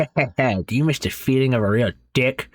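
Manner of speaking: put-on a touch of a leering old man's voice. A narrator's reading. Grandfather leers at his granddaughter-in-law, a touch of a leering voice. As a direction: sneer it like a dirty old man.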